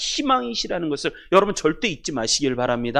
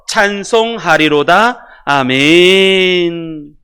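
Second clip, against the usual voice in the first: loud, measured recitation with drawn-out endings; slow, ringing verse reading with a long amen; congregational scripture reading; 10 dB louder, slower than usual. Real, not acted. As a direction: read it out in full voice for all to join. Leads congregation reading older translation aloud.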